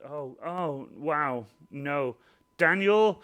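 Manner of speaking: usual speaking voice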